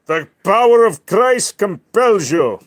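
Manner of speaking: loud, slow exorcism chant; mock exorcist. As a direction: command like a priest casting out a demon. Mock exorcism at barking dog.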